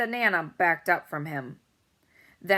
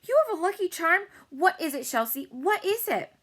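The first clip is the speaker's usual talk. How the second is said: much higher, fast, eager questions; excited; 11 semitones higher, 5 dB louder than usual. A narrator's reading. Girl eagerly demanding to know about the charm, high and fast. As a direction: pepper him with questions.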